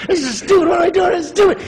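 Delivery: very high, squealed complaint; hammed-up frantic whine; mock exasperated outburst. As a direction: crack into comic desperation at the pointlessness. Same complaint escalated.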